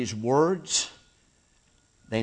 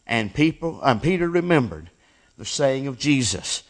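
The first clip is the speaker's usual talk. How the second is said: normal talking voice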